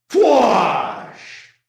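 loud shouted whoosh; blaster whoosh. Acted. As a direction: blast it out like a weapon firing. Blast melts the wall.

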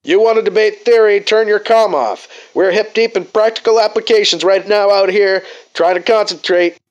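loud, high pilot over the radio; bursting over the comm. Acted. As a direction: cut in loudly over the comm. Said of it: "voice comes bursting out of the comm".

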